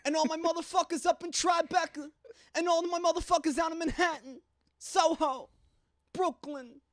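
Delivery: high-pitched hammed-up New York accent. Shouting out the boroughs, high-pitched New York accent.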